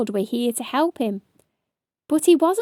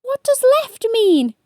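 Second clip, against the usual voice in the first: high, louder confused cry; cried; 13 semitones higher, 7 dB louder than usual. A narrator's reading. Hedgehog not knowing left, high cry. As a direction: cry it out, lost and scared. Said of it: "cried Spiky".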